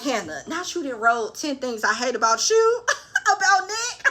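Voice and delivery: laughing through disbelief; cracking up mid-sentence. Real, not acted. Laughing at Hannah.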